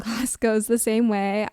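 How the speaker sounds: ordinary delivery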